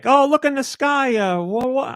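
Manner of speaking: hammed-up call to look upward; imitating game characters. Game characters pointing out a flying creature, mock-excited.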